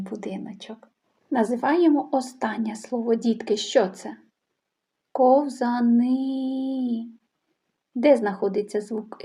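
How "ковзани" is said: «Ковзани» drawn out at a crawl, final «и» stretched; very slow sounding out. Shown as a demonstration. Teacher sounding out picture names. (дуже повільно і протяжно «Ковзани»)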